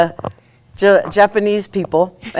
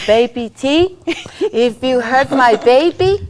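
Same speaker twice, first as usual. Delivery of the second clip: put-on slow Tongan-accented warning with a smile; sweetly menacing Tongan lilt, rising at pauses; smiling Tongan threat; 4 semitones higher than usual. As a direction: imitate a smiling Tongan mother-in-law's threat. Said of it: "With the smile and everything".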